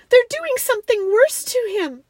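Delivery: high, loud distress; alarmed girl. A narrator's reading. Girl fears they are hurting the lion, high alarm. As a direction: cry it out in horror, pitched high. Frightened child fears for Aslan.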